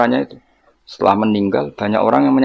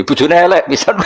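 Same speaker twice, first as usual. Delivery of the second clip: usual speaking voice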